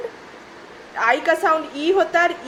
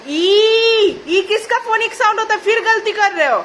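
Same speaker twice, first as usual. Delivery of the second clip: higher, louder exasperated scolding; 7 semitones higher, 4 dB louder than usual. Real, not acted. Mother scolding child over mistake.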